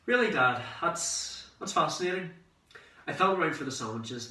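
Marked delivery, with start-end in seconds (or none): 0.1-2.3 mock fascination. Son answering imagined lecturing dad.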